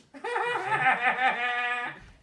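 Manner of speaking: long cackling laugh; mocking duck laugh. Duck teasing Redbird about swimming, long cackle.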